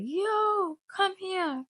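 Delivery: mother calling dad into the room; mom calling dad. Retold or imitated. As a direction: summon the husband in a mocking high voice.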